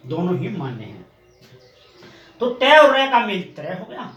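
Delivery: ordinary talk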